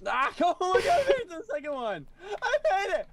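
high, fast ecstatic squealing; overjoyed squeal. Real, not acted. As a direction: squeal with joy and laughter. Finally succeeds, overjoyed.

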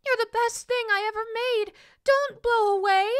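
put-on high, pleading little girl; crying out. A narrator's reading. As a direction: call after your runaway toy, loudly. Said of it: "the little girl cried".

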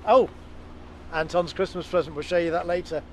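usual speaking voice

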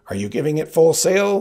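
high, loud demand; Sicilian's voice. Acted. Sicilian asking the Spaniard, high and loud. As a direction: bark the question up high.

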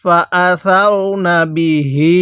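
measured chant with the last "hee" of বিহি held; slow Quran recitation. Shown as a demonstration. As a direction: recite slowly, stretching the end of বিহি. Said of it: "এক আলিফ টান দিব".

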